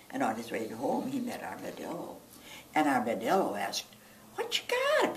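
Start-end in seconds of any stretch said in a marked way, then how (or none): 4.3-5.2 high animal voice. Acted. Armadillo voice.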